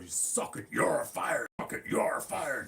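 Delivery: loud mock yelling; mock shouting. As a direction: shout it like a ranting boss. Impersonating arguing bosses.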